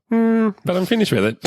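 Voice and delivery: high, stubborn little-girl voice, mock-innocent; imitating young daughter. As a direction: play the sly kid insisting she's done. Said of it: "Now it's like".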